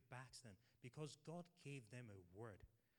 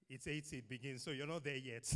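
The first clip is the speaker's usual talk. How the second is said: louder, teasing the elders; 9 dB louder than usual. Real, not acted. Teasing an older member aloud.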